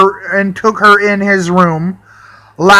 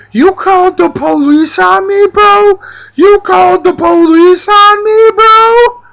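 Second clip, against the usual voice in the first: mocking imitation; 9 semitones higher, 3 dB louder than usual. Imitating roommate's protest.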